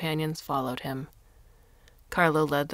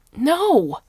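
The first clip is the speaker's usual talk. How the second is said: a high, loud, outraged cry; furious shout; 11 semitones higher, 11 dB louder than usual. A narrator's reading. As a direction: shout it down flat. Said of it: "Carlo was furious".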